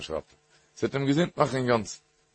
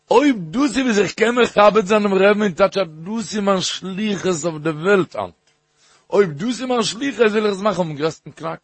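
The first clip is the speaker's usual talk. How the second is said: higher, louder, emphatic exhortation; rousing retold reply; 6 semitones higher, 4 dB louder than usual. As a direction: retell the rebbe's words with rising fervour. Retold rebbe's rousing reply to patient. (מיט התלהבות נאכגעזאגט)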